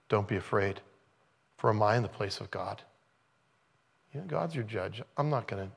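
calm them like a brother; tender Joseph. Acted. Joseph reassures his frightened brothers, soft and tender.

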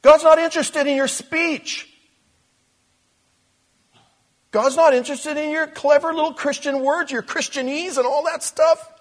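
high, loud declaration; forceful preaching. Real, not acted. Preacher to congregation, forceful and loud. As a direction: proclaim it to the back row.

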